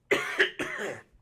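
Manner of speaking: harsh throat-clearing cough; dry cough. Real, not acted. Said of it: "It's like I inhaled dust".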